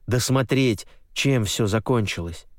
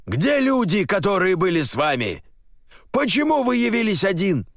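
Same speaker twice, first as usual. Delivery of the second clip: angry interrogation; 11 semitones higher than usual. Acted. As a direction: grill him furiously. General interrogates.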